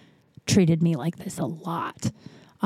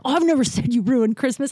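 higher-pitched, mock-indignant protest; defensive denial; 5 semitones higher than usual. Imitating father denying he said it, defensive. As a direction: protest innocence, lightly acted.

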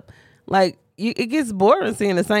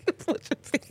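laughing; 8 semitones higher, 6 dB quieter than usual. Real, not acted.

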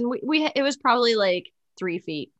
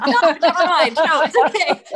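laughing; 8 semitones higher, 6 dB louder than usual. Real, not acted. Laughing after own crash story.

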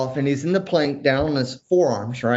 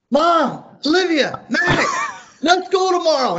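high, loud imitation of a thrilled kid; excited screaming; 5 dB louder, slower than usual. Son shouting to family running upstairs, excited screaming. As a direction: mimic a kid hollering through the house. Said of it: "he's running up the stairs screaming".

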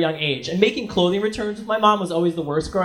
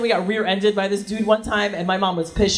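normal talking voice

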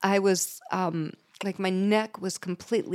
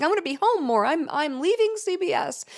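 higher, bright fake cheer to hide embarrassment; forced cheerful fib; 10 semitones higher than usual. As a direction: sell the lie with a high sunny voice. Forced cheer lying to kids.

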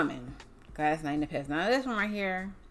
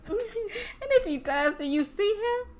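higher, slow, giggly question; laughing mock defense; 13 semitones higher, slower than usual. Friend joking about a cheating man, laughing high. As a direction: tease it through laughter.

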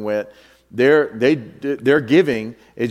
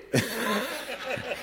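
breathy laugh; 9 semitones higher, 12 dB quieter than usual. Real, not acted. Laughing at his own story.

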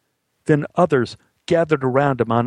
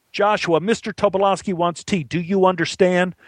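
higher-pitched, mildly bossy demand; stern order; 7 semitones higher than usual. Acted. Assistant director ordering the boy to get tea, stern. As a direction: press him for an answer.

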